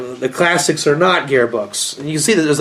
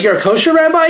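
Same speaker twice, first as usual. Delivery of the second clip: incredulous; 11 semitones higher, faster than usual. Retold or imitated. Caller's disbelief on phone.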